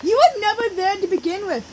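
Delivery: loud, high, fast retort; quick angry comeback; shooting back. A narrator's reading. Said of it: "he shot back".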